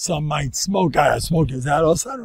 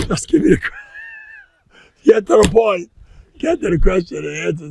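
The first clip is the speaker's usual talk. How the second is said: fast, laughing, higher push to skip ahead; impatient demand; 7 semitones higher, 8 dB louder than usual. Retold or imitated. Reader ripping out the Q and A page, impatient and laughing. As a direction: hurry them to the point, half laughing.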